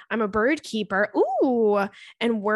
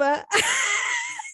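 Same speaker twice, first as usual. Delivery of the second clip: high squealing laugh; shrieking laugh. Real, not acted. Squealing after being teased.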